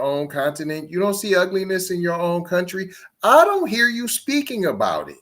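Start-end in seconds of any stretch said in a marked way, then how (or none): 3.2-5.2 high-pitched, pointed challenge; pointed accusation. Real, not acted. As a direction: call them out, pitch climbing high. Accusing clip speaker of selective criticism.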